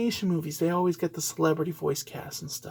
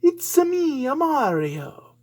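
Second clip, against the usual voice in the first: high, loud, slow put-on Mario voice; a deliberately cringy Mario catchphrase; cringy Mario impression; 8 semitones higher, 5 dB louder, slower than usual. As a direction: ham up a bad Italian plumber voice. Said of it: "Chris Pratt doing a very cringy".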